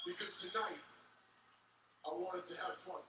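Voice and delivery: hushed aside. Real, not acted. Drops voice very low.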